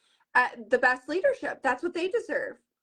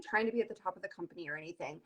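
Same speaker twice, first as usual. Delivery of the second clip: fast, much softer, laughing throwaway; laughing dismissal; 6 semitones lower, 11 dB quieter, much faster than usual. Retold or imitated. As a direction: toss it off under a laugh. Dismissive retold remark, laughing.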